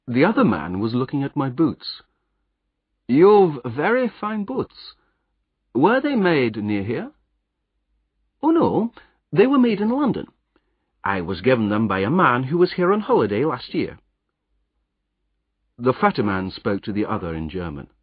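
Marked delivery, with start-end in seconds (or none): 3.1-7.1 much higher, slow, probing voice; suspicious stranger. Acted. Second agent questions the roadman about his boots, slow and probing. 8.4-14.0 a higher, lightly done Scottish roadman; Scottish accent. Acted. Disguised hero explains his boots in a Scottish accent.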